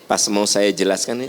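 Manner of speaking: ordinary talk, nothing marked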